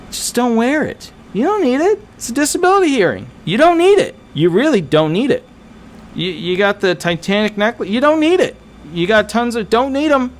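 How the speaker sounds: high-pitched, insistent repeated no; exasperated high-pitched lecture; emphatic scolding. Real, not acted. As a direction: hammer home that they don't need it.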